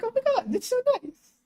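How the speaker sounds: high, lilting gush; gushing honored. Acted. Streamer reacting to a flattering comparison, high and gushing. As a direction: swoop up in flattered delight. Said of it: "what an honor to be compared".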